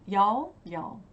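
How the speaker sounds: a hint of Southern American accent; attempted Southern accent. Shown as a demonstration. Vlogger practising a word she doubts, attempted Southern accent. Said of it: "or a, a Southern accent".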